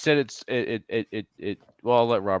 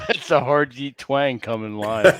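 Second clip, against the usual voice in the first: laughing; 4 semitones higher, 6 dB louder, slower than usual. Real, not acted. Laughing through hillbilly joke.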